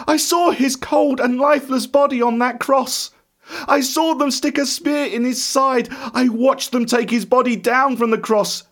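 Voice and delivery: high, distressed protest; impassioned shouting. A narrator's reading. Thomas recalling the crucifixion to friends, anguished protest. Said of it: "I shouted".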